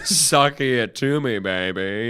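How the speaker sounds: Austin Powers impression. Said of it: "And then he goes".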